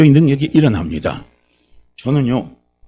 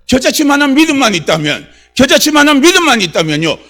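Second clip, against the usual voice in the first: raised, loud preacher's voice; fiery preaching; 9 semitones higher, 6 dB louder than usual. Real, not acted. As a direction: fire up the congregation with insistent high-pitched questions. Preaches the verse at full force.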